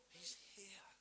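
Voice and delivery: hushed whisper; awed whisper. Real, not acted. Preacher tells the church God is present, awed whisper. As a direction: breathe it out in awe. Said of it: "preacher whispering in awe".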